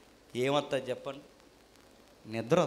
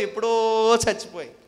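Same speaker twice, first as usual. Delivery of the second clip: usual speaking voice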